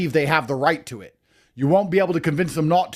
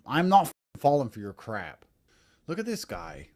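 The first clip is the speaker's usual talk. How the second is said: usual speaking voice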